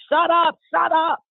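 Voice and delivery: sharp retold scream, pitched well up; retold yelling. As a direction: imitate a cop yelling orders. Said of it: "immediately started yelling and screaming".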